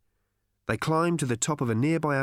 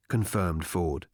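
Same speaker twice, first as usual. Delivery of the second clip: usual speaking voice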